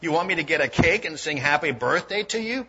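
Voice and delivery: mock her birthday wish with contempt; nasty retort. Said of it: "Her friend replied nastily".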